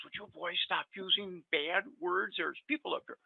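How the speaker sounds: a hint of a fussy scolding nurse. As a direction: gently chide the boys, as a retold nurse. Nurse's scolding quoted in recovery room.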